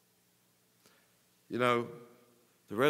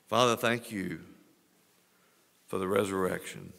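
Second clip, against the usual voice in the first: earnest prayer rising louder toward the end; closing prayer; 6 dB louder than usual. Acted. Pastor leading the standing church in prayer, fervent prayer voice. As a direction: pray over them, building in fervour.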